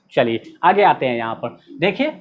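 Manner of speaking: ordinary delivery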